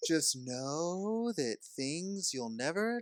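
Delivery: drawn-out, sing-song tease of a co-host; teasing imitation. Co-host imitating friend's hints about next week's book, teasing. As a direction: mimic her stringing out a vague hint. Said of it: "She's like".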